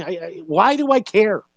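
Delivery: normal talking voice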